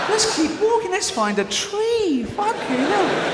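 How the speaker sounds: very high, squeaky whining voice, the slow last lines dragged out; freezing Inuit. Acted. Inuit at the back begs the leader to keep walking, squeaky high whine.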